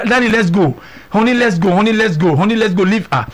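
high, repeated plea to leave; pleading wife. Retold or imitated. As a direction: coax him away again and again. Wife pleading in retold scene.

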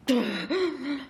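high gasp for air; mighty gasp. Acted. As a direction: gasp hard after surfacing. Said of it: "a mighty gasp for air".

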